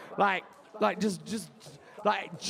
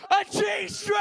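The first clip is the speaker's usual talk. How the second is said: high, loud triumphant punchline; punchline shouted to the room; 10 semitones higher, 5 dB louder, slower than usual. Real, not acted. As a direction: belt out the payoff.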